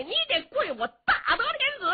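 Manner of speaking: high, laughing mockery on 大德天子; laughing taunt. Cheng Yaojin taunting the emperor, laughing.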